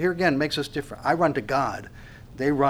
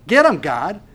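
a loud, higher plea; urging shout; 4 semitones higher, 7 dB louder than usual. Retold or imitated. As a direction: urge God on, loudly. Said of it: "that's our feeling".

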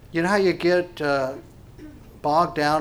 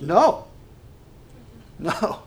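zealous no; 4 semitones higher than usual. Acted. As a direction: answer as an eager, tireless God. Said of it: "you can hear the zealousness in his voice".

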